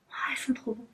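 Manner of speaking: soft delighted whisper. Real, not acted. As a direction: breathe it out under your breath. Aside while smelling. (chuchotement ravi)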